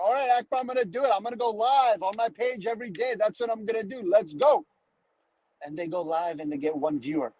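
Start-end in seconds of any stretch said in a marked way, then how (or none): 0.0-4.6 higher, keen and pumped up; hammed-up self-motivation; mock eager pep talk. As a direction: play the fired-up newbie. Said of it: "Some people are like".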